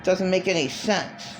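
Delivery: ordinary talk, nothing marked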